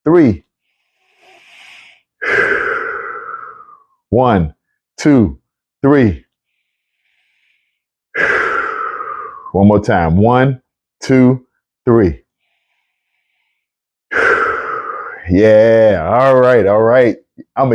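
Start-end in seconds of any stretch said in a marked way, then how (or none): 2.2-3.4 long, breathy release of air; long exhale. Real, not acted. Host leading listeners on count of three, long exhale. 8.1-9.5 long, breathy release of air; long exhale. Real, not acted. Host leading listeners on count of three, long exhale. 14.1-15.2 long, breathy release of air; long exhale. Real, not acted. Host leading listeners on count of three, long exhale.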